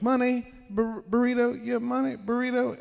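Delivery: high singsong begging for money; begging act. Retold or imitated. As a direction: plead high like a street beggar. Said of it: "stopped the whole act and goes".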